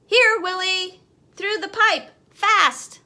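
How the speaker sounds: loud, high call to the dog; urgent call. A narrator's reading. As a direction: shout it down the pipe, urgent.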